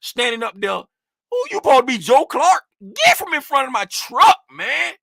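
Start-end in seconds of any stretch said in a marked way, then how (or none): 1.3-4.9 loud, high, heated re-enacted challenge; rowdy. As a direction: get worked up retelling how you told him off. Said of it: "I started getting rowdy".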